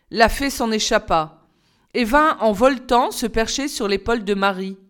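usual speaking voice

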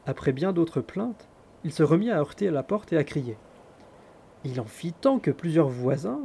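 usual speaking voice

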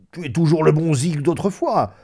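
high, loud, chummy; hearty backslapping familiarity; boisterous old friend. Acted. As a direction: sound him out like an old pal. Friend heartily teases old pal.

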